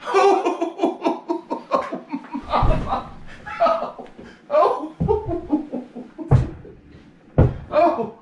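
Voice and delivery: hammed-up falsetto cries of agony; pained howls. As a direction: howl like you just got kicked in the groin, cracking up. Slicker kicked in crotch.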